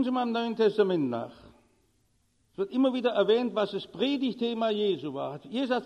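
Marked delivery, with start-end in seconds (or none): none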